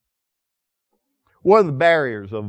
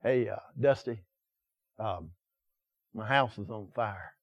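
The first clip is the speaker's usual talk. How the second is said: soft, hesitant plea from next door; hushed neighbor asking for help; 5 semitones lower, 12 dB quieter than usual. Acted. Neighbor tells Dusty his house is burning, hushed and hesitant. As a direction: ask for help quietly, almost apologetic.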